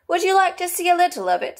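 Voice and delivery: high, quick, keen turtle voice; Mock Turtle. A narrator's reading. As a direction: offer it eagerly, pitched right up. Mock Turtle's dialogue in story.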